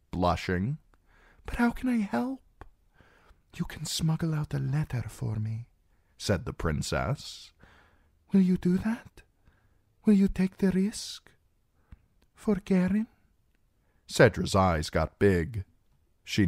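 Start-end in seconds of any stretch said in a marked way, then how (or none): 1.5-2.6 put-on high, timid young girl; shy servant girl. A narrator's reading. Servant girl asks eagerly. 3.5-5.7 high, softer conspiring princess; cartoon princess. A narrator's reading. Princess voiced in audiobook. 8.3-13.1 put-on high, coaxing young princess; cartoon princess. A narrator's reading. Princess voiced in audiobook.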